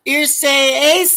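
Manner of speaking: high, drawn-out pig Latin call; Larry impression. Imitating Larry in the Stooges' pig Latin, high-pitched and drawn out. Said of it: "Larry says".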